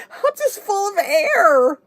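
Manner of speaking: slow, high words through laughing tears; laughing cry. Retold or imitated. Answering Steve about the noise, slow high laughing wail. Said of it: "I'm like".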